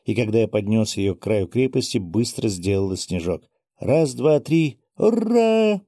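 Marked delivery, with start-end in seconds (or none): 3.8-5.8 building from raised count to squealed hurrah; excited countdown. A narrator's reading. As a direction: count up to a high, drawn-out cheer. Child counts down, throws snowball. (счёт и звонкое ура)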